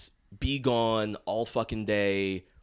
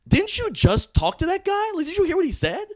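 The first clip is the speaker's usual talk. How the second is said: incredulous disbelief; 3 dB louder than usual. Retold or imitated. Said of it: "And I'm like".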